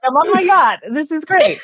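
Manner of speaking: high, loud, breezy rich-guy voice; smug billionaire. Retold or imitated. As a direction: play a self-satisfied tycoon on a yacht. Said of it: "he's like".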